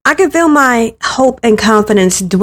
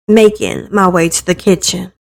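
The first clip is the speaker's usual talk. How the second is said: plain reading voice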